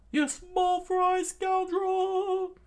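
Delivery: very high, slow, sung-out shout; high drawn-out singsong bellow; furious shout. Acted. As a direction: howl the insult, stretching it. Don Krieg insults Luffy.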